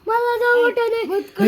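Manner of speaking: loud, pleading, half-playful scared protest; scared whine. Pretend. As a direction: whine that you don't like it. Scared child in ghost game. (घाबरून मोठ्याने तक्रार)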